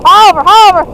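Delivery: loud musher command; calling commands. Real, not acted. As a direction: call it out to the team.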